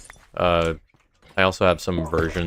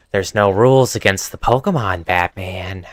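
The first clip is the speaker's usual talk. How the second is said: louder put-on voice for a comic villain; requested villain impression; 4 dB louder than usual. Shown as a demonstration. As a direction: play the villain taunting Batman. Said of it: "a sudden urge to hear you say that".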